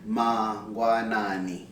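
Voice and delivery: Mangwanani slowly, every vowel full; vowels drawn out. Shown as a demonstration. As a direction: stretch each vowel of Mangwanani. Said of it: "we're gonna use vowels".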